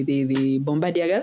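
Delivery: ordinary talk, nothing marked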